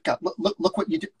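a hint of a busy New York agent; agent on the phone. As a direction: brush him off mid-call. S0 reads George.

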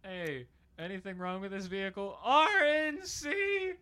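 "R N C" belted very high, loud and slow like a car ad jingle; mock ad slogan. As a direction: yell the brand name like a cheesy commercial.

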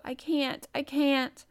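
high, helpless wail; gasping and sobbing. A narrator's reading. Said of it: "he gasped and sobbed".

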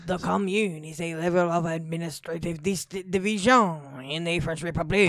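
put-on reading in a French accent; French accent on the second try. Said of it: "Read it in a French accent".

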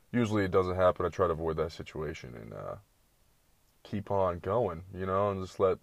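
usual speaking voice

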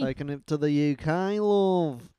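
badly faked British accent, drifting towards Australian; mock British accent. Co-host echoes the UK intro as a joke, mock British accent. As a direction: put on a playful, muddled British accent. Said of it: "Is that a British accent".

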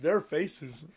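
usual speaking voice

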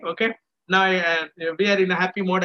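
normal talking voice

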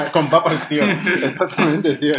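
loud, laughing agreement; words bursting out through real laughter. Real, not acted. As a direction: crack up while answering. Laughing at own joke about pigs.

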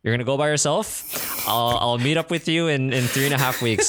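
brisk mom voice. Retold or imitated. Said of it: "She was like".

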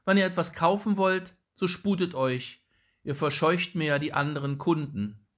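impatient shopkeeper mother. A narrator's reading. Shoemaker's wife hurrying the old woman along, fast and loud. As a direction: hurry her along, fed up. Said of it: "im Unmut über das lange Prüfen".

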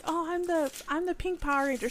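lightly acted childish excitement; kid claiming a role. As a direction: call dibs like a child picking a character. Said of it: "you know how you're like".

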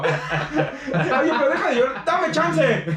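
high, giggling request to back off; laughing mock plea. Joking with friends about the date, high laughing plea. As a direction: ask for a break while laughing.

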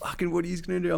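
put-on high-pitched drunken goading; imitating his own cheeky challenge; smart-ass taunt. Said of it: "I'm just being a smart ass".

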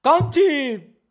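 high, loud mock screaming across a square; bellowed impression of someone shouting insults; imitated yelling. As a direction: yell it out like a hollering gamer. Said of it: "porque tú eras de gritar".

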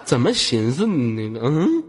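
stretched out in disbelief; slow, drawn out. Real, not acted. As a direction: drag the words out slowly. Mocking disbelief.

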